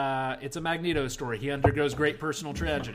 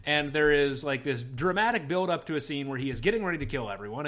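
ordinary delivery

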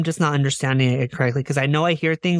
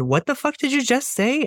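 angry; 9 semitones higher than usual. Retold or imitated. Retold confronting friend in car.

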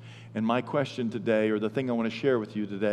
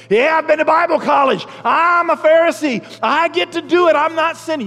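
high, loud mocking zealot voice; mock a self-righteous young zealot; cocky Pharisee; 10 dB louder than usual. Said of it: "the passionate guys going".

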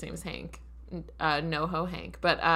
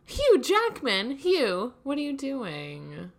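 mock scolding; 7 semitones higher, slower than usual. Real, not acted. Cohost pretends to scold Hugh Jackman, exasperated. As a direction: scold him like a naughty friend.

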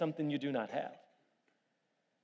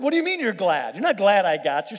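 mock disbelief; 4 semitones higher, 8 dB louder than usual. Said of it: "I'm like".

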